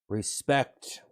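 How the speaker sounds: usual speaking voice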